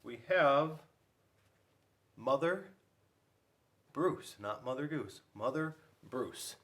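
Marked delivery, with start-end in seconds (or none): none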